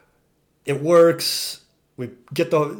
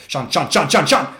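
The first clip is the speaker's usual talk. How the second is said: rising, louder repeated name as a warning; calling out urgently; 2 semitones higher, 7 dB louder than usual. Retold or imitated. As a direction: call his name faster and more urgent each time. Warning driver near road edge.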